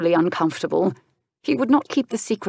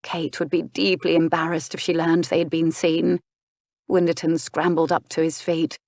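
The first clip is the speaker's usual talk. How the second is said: usual speaking voice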